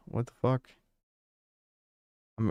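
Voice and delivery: normal talking voice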